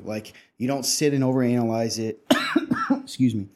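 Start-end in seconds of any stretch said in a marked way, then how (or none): 2.6-3.5 coughing fit mid-sentence; sputtering, clearing the throat; choking on a drink. Real, not acted. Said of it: "I'm choking to death on this episode".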